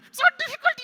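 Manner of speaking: very high, loud puppet squeal; exasperated puppet. Pretend. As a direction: give up loudly as the puppet. Puppet gives up on twister.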